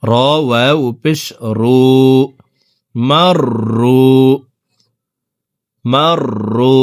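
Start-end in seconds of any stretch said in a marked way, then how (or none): 2.9-4.1 রু drawn out with rounded lips for one alif; slow madd on রু, one alif long; long rounded vowel. Shown as a demonstration. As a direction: stretch রু slowly, lips rounded. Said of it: "ঠোঁট গোল করে একালিপ টেনে পড়তে হবে".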